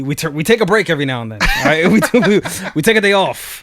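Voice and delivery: exasperated. Retold or imitated. Snapping back at pushy coworker.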